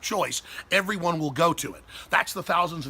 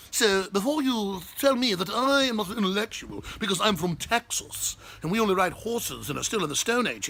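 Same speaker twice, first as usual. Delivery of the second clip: put-on English accent. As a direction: play a haughty English lecturer with a faint English accent. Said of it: "I'll do it with an English accent".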